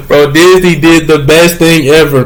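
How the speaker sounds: talking through a delighted laugh. Real, not acted. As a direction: gush about it while still laughing. Laughing while praising the show.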